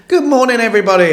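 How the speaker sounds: high, loud cheery greeting; hearty call-out. Pretend. Host greeting morning listeners, hearty high call-out. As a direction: call it out high to a whole audience.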